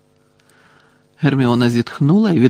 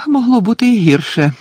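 higher-pitched resigned sigh; exasperated, sighed remark; 7 semitones higher, 4 dB louder than usual. A narrator's reading. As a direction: sigh it out, pitch falling away. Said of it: "зітхнула вона".

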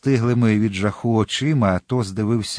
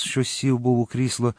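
usual speaking voice

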